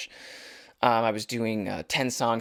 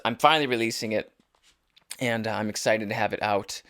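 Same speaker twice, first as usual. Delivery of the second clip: ordinary talk, nothing marked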